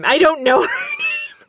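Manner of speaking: laughing it out high and loud; cracking up mid-sentence. Real, not acted. Laughing hard while telling listeners about the neighbor.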